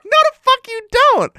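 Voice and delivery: high, loud mock protest; retold alarmed refusal. Son refusing to let his mom look, mock alarmed yell. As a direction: yelp the refusal, half laughing.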